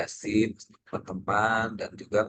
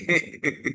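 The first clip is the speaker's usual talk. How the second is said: short high laugh; 9 semitones higher, 4 dB louder than usual. Real, not acted. Embarrassed at rating himself low.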